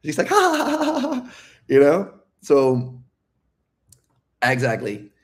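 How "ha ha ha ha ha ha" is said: high mock giggle, ha ha. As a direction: imitate her girly laugh lightly. Girl laughing, mocked.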